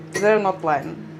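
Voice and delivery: ordinary delivery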